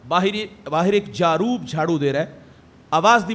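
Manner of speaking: usual speaking voice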